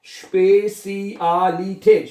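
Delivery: स्पेसियालिटेट slowly, every vowel stretched; स्पेसियालिटेट as slow "shpay-see-aah-lee-tate"; broken into syllables. Shown as a demonstration. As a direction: sound out स्पेसियालिटेट piece by piece. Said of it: "लफ्ज को तोड़ लें".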